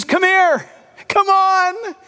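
Jesus calling the sinner close, lightly voiced welcome; inviting call. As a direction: beckon the sinner in gladly.

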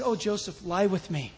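soft, low come-on; seductive Potiphar's wife. Acted. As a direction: murmur it as an invitation. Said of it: "She said".

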